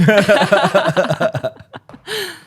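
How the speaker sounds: loud high burst of laughter; real loud laughter; laughing hard. Real, not acted. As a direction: crack up at the picture. Imagining the scene.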